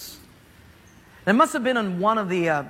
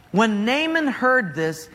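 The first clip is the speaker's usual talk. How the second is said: ordinary talk, nothing marked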